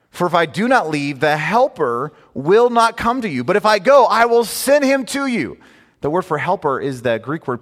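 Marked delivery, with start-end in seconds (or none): 0.1-5.6 rising higher and louder, slowing to the end; preached scripture climbing in force; building scripture reading. Acted. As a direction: swell into the promise.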